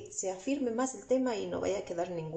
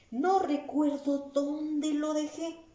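slow, higher, drawn-out puzzling; slow, higher musing to oneself; worried mother; 6 semitones higher, slower than usual. Acted. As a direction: wonder aloud slowly, higher than usual. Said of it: "Entonces está preocupada y dice".

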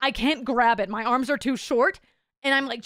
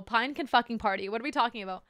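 usual speaking voice

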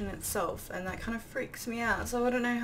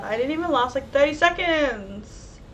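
loud indignant complaint; 4 semitones higher, 7 dB louder than usual. Real, not acted. Player died almost at once, indignant complaint. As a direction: wail it louder, stretching the end.

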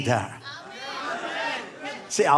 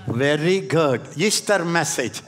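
ordinary talk

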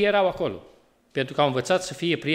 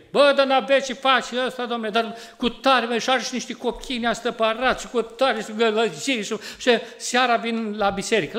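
high, scornful complaining about the neighbour's family; mock badmouthing; 6 semitones higher than usual. Father badmouthing a neighbour's family, high and scornful. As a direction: sneer at Doru's noisy kids in a raised voice. Preacher mimics himself badmouthing Doru.

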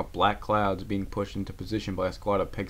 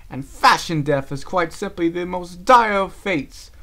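higher, louder lofty lecture; haughty; 6 semitones higher, 7 dB louder than usual. Acted. Rarity lectures Applejack on fashion. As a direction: look down your nose at her. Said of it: "her face grew haughty".

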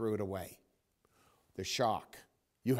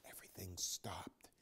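breathy hushed "everything stopped"; whisper; 5 semitones lower, 15 dB quieter than usual. A narrator's reading. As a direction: let it fade into a whisper. Story climax, stillness.

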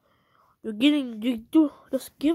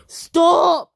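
sudden furious yell of stop; angry yell; 5 semitones higher, 11 dB louder than usual. Real, not acted. Kid yelling at the hater, furious shout. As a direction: blast it out to make him quit.

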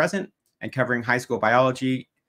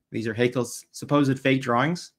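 ordinary talk

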